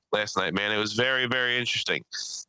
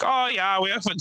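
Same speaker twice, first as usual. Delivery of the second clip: a high, lightly put-on German accent; German accent, upbeat; 7 semitones higher than usual. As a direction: mimic the German tourists, a bit high. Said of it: "they sounded like".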